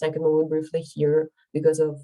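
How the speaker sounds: usual speaking voice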